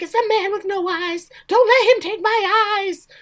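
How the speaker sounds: high, loud mock-hysterical plea; imitated terrified grandma. Grandma begs child about the eyeless man, mock terrified. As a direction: plead in hammed-up terror. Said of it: "Now she's screaming".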